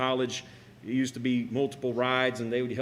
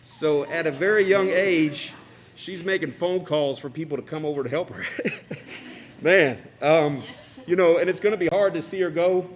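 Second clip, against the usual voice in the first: choked up; 4 semitones higher, 6 dB louder than usual. Real, not acted. Father tearing up at speech.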